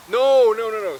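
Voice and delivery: laughing denial. Real, not acted. Laughing at own retelling.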